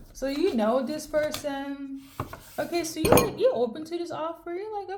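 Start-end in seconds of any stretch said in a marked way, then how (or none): none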